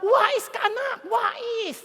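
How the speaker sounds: slow, put-on voice of a doting dad; indulgent father. Father praises his thieving child, mock admiring. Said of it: "ang tatay mo sabi".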